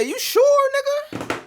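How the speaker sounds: high, loud incredulous yelp; shocked disbelief. Retold or imitated. Answering the doctor on the phone, high shocked yelp.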